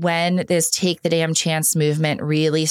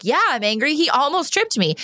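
put-on higher, indignant kid voice; angry little daughter; 8 semitones higher than usual. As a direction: play the cross little girl.

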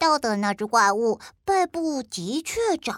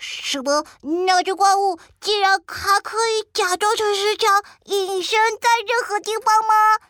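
nervous; 8 semitones higher than usual. Acted. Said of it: "阿德好紧张哦".

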